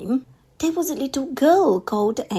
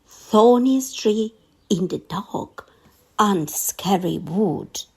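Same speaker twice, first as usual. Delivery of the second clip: ordinary delivery, nothing marked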